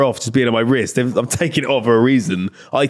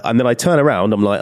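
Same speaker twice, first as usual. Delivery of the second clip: ordinary delivery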